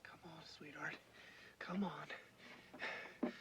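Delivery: soft, unvoiced coaxing; tender whisper. Real, not acted. Rescuer coaxing the baby through the hole, whispered.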